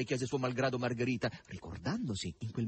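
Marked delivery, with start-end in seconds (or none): none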